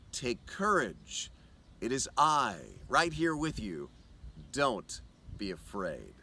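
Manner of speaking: whisper-close comfort to someone afraid; soft reassurance. Acted. Jesus with you in storms.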